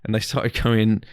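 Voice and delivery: normal talking voice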